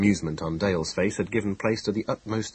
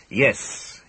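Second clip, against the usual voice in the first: short, louder irritated yes; curt and irritated; impatient snap; 5 semitones higher, 6 dB louder than usual. A narrator's reading. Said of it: "he snapped impatiently".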